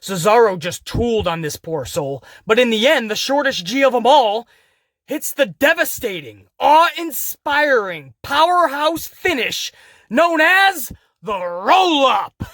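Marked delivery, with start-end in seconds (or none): 5.0-12.4 slow, higher-pitched hype build-up; mock-epic announcing. Announcer building up a wrestling finish for a joke, mock-epic. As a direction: stretch out each word like a grand finisher. Joking hyped-up build to a move.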